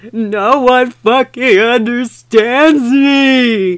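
loud, high, slow mock wail; hammed-up emo shout; angry shout. A narrator's reading. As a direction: ham up a furious teen outburst. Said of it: "he shouted angrily".

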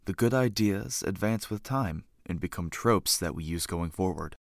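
low, measured announcer read; show intro narration. Acted. Host opening the trope podcast, measured announcer narration.

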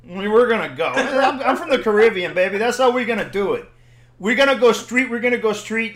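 fired-up street bravado; testy. Retold or imitated. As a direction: act out getting riled up and cocky. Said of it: "I'm getting testy now".

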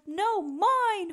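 high hollered retort; mild holler. Acted. Bruce hollers back at Cyril, mild. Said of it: "hollered Bruce".

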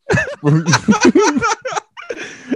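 a held-back laugh bursting out high; stifled laugh. Real, not acted. Host laughing at the friend's death story, stifled laugh. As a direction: laugh you were trying to hold in.

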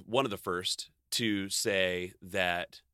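usual speaking voice

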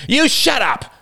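high, loud furious yell. A narrator's reading. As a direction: snap at the crying girl to stop. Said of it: "he yelled".

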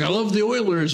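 put-on high, cheerful old patient. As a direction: say it proudly as an old fan. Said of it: "He's like".

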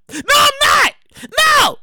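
high, emphatic protest; outraged high-pitched denial; indignant refusal. Acted. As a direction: refuse outright with a high holler. Indignant refusal after mocking question.

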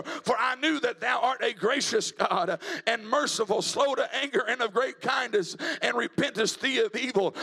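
loud, driving revival sermon delivery; full-force preaching. Real, not acted. Preacher telling the church about Jonah and God's mercy, full-force preaching. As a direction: preach it hard, pitch climbing to the close.

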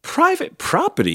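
high, loud warning yell. A narrator's reading. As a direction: yell it to warn off a trespasser. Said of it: "yelled Hank".

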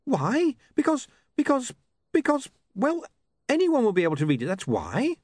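high, stammering character voice; flustered character voice. Acted. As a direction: flounder for an answer, voice up high.

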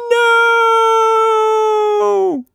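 put-on high, shrill baby shout; baby screaming. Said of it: "she screamed".

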